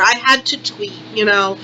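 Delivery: ordinary delivery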